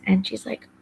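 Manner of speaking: ordinary talk, nothing marked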